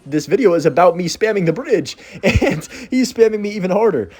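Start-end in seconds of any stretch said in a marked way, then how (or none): none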